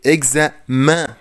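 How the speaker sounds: اكزامان said slowly ending on a nasal 'ã'; اكزامان with the last n swallowed into the nose; slow demo. Shown as a demonstration. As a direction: model اكزامان slowly for the class. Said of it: "فبقول ايه؟".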